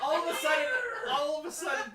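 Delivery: loud laughing build-up; excited. Real, not acted. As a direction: shout it half laughing. Excited narration, laughing shout.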